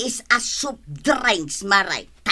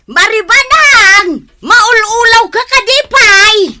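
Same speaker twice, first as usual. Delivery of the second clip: loud exclamation; 10 semitones higher, 12 dB louder than usual. Acted. Woman loudly teases her worried neighbour, high exclamation. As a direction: call it out loud and teasing.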